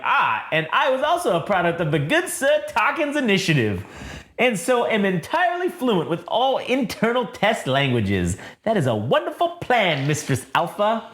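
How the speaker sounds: high, mannered robot butler; fussy protocol droid. Acted. Droid praising his mistress's plan, high and mannered.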